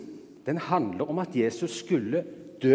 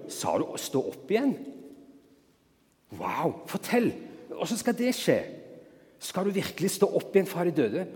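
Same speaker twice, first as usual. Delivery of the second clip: higher, eager questions; eager amazement; 5 semitones higher than usual. Pretend. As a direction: burst out curious and thrilled. How Peter should have reacted. (ivrig og forbløffet)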